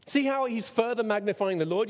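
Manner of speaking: normal talking voice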